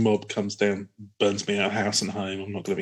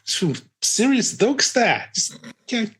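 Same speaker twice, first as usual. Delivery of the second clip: Aussie alien; 8 semitones higher than usual. Pretend. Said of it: "impressions. They're all bad".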